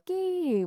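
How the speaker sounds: high squeaky creak like a rusty pulley; creaking pulley sound. Shown as a demonstration. Teacher imitating the pulley's creak for the class, high squeak. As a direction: squeak it out like an old wheel turning. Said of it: "도르래가 또 소리를 냈대요".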